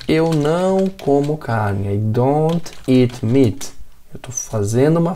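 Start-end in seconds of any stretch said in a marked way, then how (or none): 0.1-1.9 slow, deliberate model sentence; teacher dictating an example to students. Shown as a demonstration. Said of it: "Por exemplo". 1.9-3.7 each English word slow and clear; teacher modelling English for students, slow; slow example sentence. Shown as a demonstration. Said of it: "Por exemplo".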